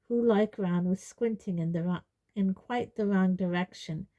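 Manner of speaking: plain reading voice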